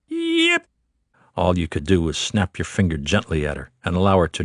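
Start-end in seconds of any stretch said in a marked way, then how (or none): 0.1-0.7 very high yelping dog squeal; dog yip. A narrator's reading. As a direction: yelp it like a tiny Chihuahua in pain. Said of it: "she'd squeal in pain".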